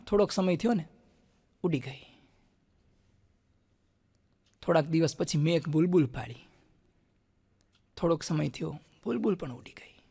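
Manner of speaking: hushed mock-profound parable; deep and solemn. Shown as a demonstration. Telling a friend a moral tale, sinking to a whisper. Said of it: "એકદમ ગહન થઈને મેં એને બોધ કથા કીધી".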